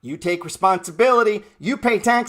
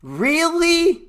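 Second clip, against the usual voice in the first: Really? pitched much higher, drawn out in disbelief; incredulous; 8 semitones higher than usual. Acted. Taunting people shocked by covid with a high drawn Really?. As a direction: stretch Really? high in mock astonishment.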